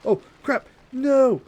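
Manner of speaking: high, loud wail of dismay; dismayed cry. Real, not acted. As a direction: cry out "no" as the game goes wrong. Game goes wrong, cries out.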